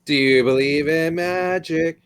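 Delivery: high, slow, eerie singsong; Pyro trailer voice. Retold or imitated. Quoting TF2 Pyro trailer.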